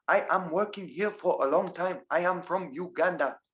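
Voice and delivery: bouncing, syllable-by-syllable Ugandan accent imitation; Ugandan English accent. Teacher imitating how they speak in Uganda, Ugandan accent. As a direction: put on Ugandan English, every word uncontracted. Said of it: "they speak like this".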